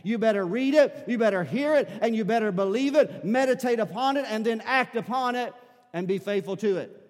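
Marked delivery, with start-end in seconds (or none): 0.0-5.5 louder and higher, preaching hard; building preacher's call; rising exhortation. Real, not acted. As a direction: drive each you better home harder.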